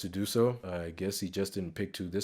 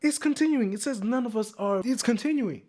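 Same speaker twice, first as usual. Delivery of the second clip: much higher, louder disbelief; incredulous; 4 dB louder than usual. Real, not acted. Host scrolling a very long comment, high incredulous disbelief. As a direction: sound amazed that it won't end.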